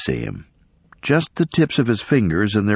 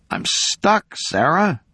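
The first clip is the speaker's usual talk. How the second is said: trembling voice; 10 semitones higher, slower than usual. A narrator's reading. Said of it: "His voice trembled".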